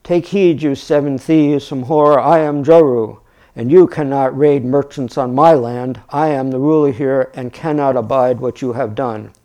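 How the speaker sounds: loud, commanding narrated proclamation; booming shout. A narrator's reading. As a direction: project it like a moderated battle challenge. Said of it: "shouted at the bandits in a booming voice".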